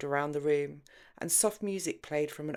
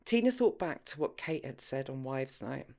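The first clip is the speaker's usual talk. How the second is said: plain reading voice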